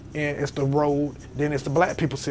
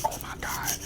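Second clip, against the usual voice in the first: breathless frightened whisper; scared whisper; 9 dB quieter than usual. Retold or imitated. Alone at three in the morning, whispering in fright. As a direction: whisper it so nothing hears you. Cats fighting in the dark.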